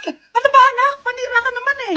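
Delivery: high-pitched irritated complaint; annoyed mother. Acted. Mother interrupted while filming TikTok, high irritated scolding.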